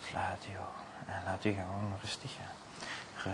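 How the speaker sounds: slow whisper, easing them under; hypnotic whisper. Acted. Hypnotist to sleeping volunteer, slow soft whisper. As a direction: breathe the words, slow and soft.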